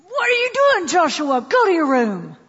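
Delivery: sharp scolding, high pitched start; harsh scolding mother. Retold or imitated. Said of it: "the tone of voice that she just expressed".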